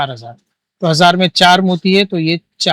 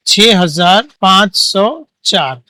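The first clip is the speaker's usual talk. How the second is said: slow dictation; much slower than usual. Shown as a demonstration. Teacher reads answer slowly.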